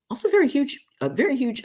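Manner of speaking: high, bright gush over very huge; gushing with enthusiasm; excited. Real, not acted.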